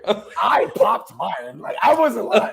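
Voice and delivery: high, loud, laughing denial; laughing protest. Real, not acted. Explaining he wasn't lying to girlfriend, laughing protest. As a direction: protest while breaking into laughter. Laughing while denying in story.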